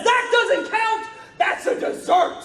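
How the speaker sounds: high, slow outraged yell; shouted protest after slamming the table; mock outraged shout. Colleague slams table, protests.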